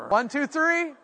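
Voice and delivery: high, rousing count-in; relished, lively delivery. Shown as a demonstration. Preacher cueing everybody to say it like this, rousing. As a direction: lift the count high with gusto. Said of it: "But say it like this".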